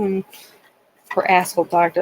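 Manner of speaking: ordinary talk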